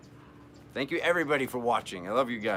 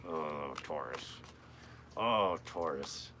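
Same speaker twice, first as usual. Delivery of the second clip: low, slow fond sigh; tender sigh; 8 semitones lower, 6 dB quieter, slower than usual. Acted. Reader sighs fondly at Taurus viewers, low and slow. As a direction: sigh the name lovingly, drawn out.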